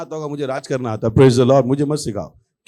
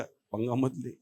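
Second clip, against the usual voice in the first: soft, low warning; hushed caution; 4 semitones lower, 11 dB quieter, slower than usual. Retold or imitated. Cautious onlooker advising against trouble, hushed warning. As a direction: warn quietly under your breath.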